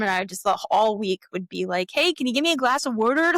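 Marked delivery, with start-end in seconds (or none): 1.9-3.3 put-on goofing off in a Delco accent; higher, playful Delco imitation. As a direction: ask in a mock Delco accent. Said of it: "just to goof off".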